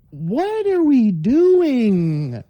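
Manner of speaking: drawn-out, very slow exasperated wail; long, pleading cry of exasperation; exasperated plea. Acted. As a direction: stretch every word in disbelief. Exasperated plea about online arguing.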